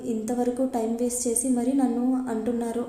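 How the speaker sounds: ordinary delivery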